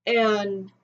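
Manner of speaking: usual speaking voice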